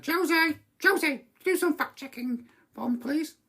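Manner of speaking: high-pitched summons to an assistant; calling over intercom. Retold or imitated. Boss on the intercom asking for fact checking, high calling voice. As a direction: call out high for someone in another room. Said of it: "I got on the intercom and said".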